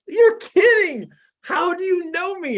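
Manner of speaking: astonished disbelief. Retold or imitated. Amazed a stranger knows him.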